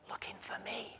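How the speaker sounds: tiny unvoiced whisper; little voice. Hiding boy whispers the punchline to the boss. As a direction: breathe the punchline like a hiding child. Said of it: "the little voice says".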